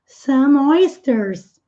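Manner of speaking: "some oysters" said slowly, the M of "some" running straight into "oysters"; slow, glide "some" into "oysters" with no break; linked demo. Shown as a demonstration. Teacher shows students the linked sound, slowly. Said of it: "Como o E não é pronunciado, acaba o som do M emendando aqui".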